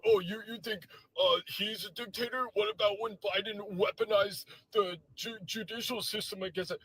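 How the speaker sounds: high, loud sneering mock voice; a hint of a sneering MAGA guy; mocking a right-winger. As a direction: parody their smug comeback.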